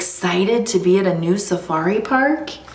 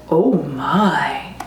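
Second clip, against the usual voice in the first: lower, hushed owl voice; 4 semitones lower than usual. Acted. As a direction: murmur it low, like a concerned owl. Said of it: "And the owl says".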